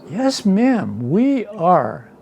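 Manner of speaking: reply like a polite fake Southerner; fake Southern accent. Husband confirming to the old lady, fake Southern accent. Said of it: "in my best fake Southern accent".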